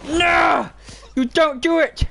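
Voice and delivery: high, loud yell; alarmed shout. Real, not acted. Gamer protesting at the game, alarmed shout.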